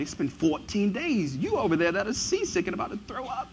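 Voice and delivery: put-on theatrical Hollywood British accent. Preacher mocking a movie Paul calmly addressing hungry sailors, British accent. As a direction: play a stately biblical hero in mock British. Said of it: "biblical characters have a British accent".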